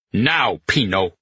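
barked order. A narrator's reading. General barks at a frozen Pino, moderately forceful. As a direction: bark it as a curt command. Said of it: "barked at him".

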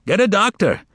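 high call across the room. A narrator's reading. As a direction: shout for help from the crowd. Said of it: "a voice called out".

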